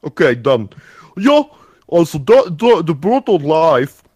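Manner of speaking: a hammed-up, mixed Russian-German accent. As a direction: play a clumsy Russian comrade. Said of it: "Bird Life explained by a Russian".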